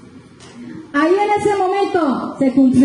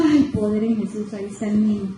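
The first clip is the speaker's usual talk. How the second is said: relieved sigh; 7 semitones lower, 6 dB quieter than usual. Retold or imitated. Relief on finding the child.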